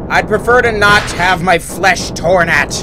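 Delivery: loud, annoyed yelling while fleeing; being chased. Real, not acted. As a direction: shout at the monster grabbing you. Yelling at chasing monster.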